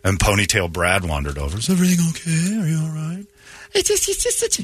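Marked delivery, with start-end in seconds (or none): 1.6-3.2 higher-pitched, softening into a question; concerned swooping nice guy. Acted. Ponytailed coworker checks on crying girl, falsely concerned. 3.7-4.6 very high, stammering put-on voice; mock upset girlfriend. Crying girl answers the coworker, mock distraught.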